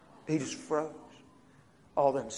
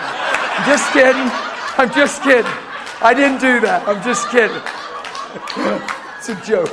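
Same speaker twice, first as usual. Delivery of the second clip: laughing it off; 7 semitones higher, 10 dB louder than usual. Real, not acted. Laughing off his own joke.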